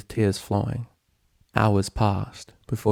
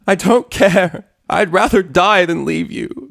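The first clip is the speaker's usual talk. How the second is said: high, loud, slow anguished scream; 10 semitones higher, 9 dB louder, slower than usual. A narrator's reading. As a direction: scream it back through tears. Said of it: "Spike screamed back".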